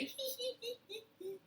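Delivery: high little tittering laugh; giggle. Shown as a demonstration. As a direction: show a tiny high giggle. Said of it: "we say".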